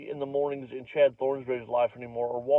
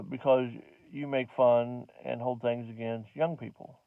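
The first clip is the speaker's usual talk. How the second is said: normal talking voice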